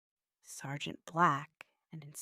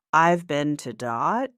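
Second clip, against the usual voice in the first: louder answer, broken off on "Dot"; stopping short; 10 dB louder than usual. A narrator's reading. John nearly telling the sergeant, stopping short at "Dot". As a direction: break off suddenly at "Dot". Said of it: "John stopped".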